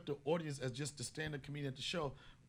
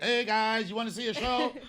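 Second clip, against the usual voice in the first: calling out; 6 semitones higher, 9 dB louder than usual. Retold or imitated. Retold calling out to passersby.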